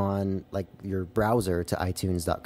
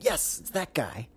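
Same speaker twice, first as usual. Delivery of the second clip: excited recognition; 5 semitones higher, 3 dB quieter, slower than usual. Retold or imitated. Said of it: "We'd be like".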